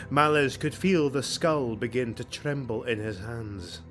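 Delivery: plain reading voice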